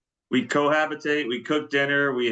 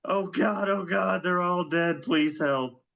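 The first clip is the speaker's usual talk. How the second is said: high, pleading, frantic caller; panicked caller; 6 semitones higher than usual. Pretend. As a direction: beg for help, voice raised high. Panicked caller in role-play.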